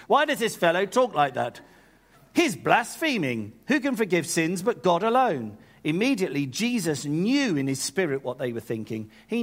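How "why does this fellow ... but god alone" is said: haughty British outrage, raised pitch. Acted. Stuck-up theologians accusing Jesus of blasphemy, very British. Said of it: "They were very British".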